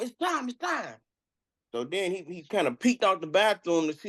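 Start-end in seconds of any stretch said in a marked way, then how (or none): 0.0-1.0 high-pitched insistent summons; calling through door. Acted. Orderly outside the bathroom door, high insistent call. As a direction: call it high, twice over.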